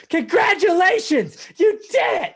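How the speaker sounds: cartoonish elf cheer, way up high; Buddy the Elf. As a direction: squeal it like an overjoyed Buddy the Elf. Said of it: "I just did the, the Buddy the Elf".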